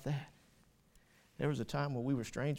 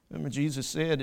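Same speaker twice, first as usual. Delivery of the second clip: ordinary talk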